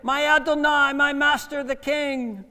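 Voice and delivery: high, loud call to a king. Acted. David hailing King Saul from the cave, loud call. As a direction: cry out to your master from afar.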